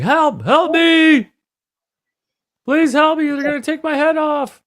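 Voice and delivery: high-pitched hammed-up cry for help; imitated frantic high scream for help; mock screaming.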